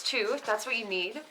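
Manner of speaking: usual speaking voice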